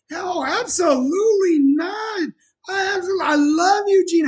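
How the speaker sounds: hammed-up gushing as if overwhelmed with joy; mock emotional outburst of delight on the phone; overjoyed cry. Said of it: "here's how it played in my head".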